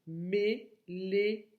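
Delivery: "méléon" slow, as mé, lé, on; syllable by syllable. Shown as a demonstration. Teacher giving what remains, "méléon" split by syllable. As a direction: separate each syllable of "méléon". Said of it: "enlèves la première syllabe".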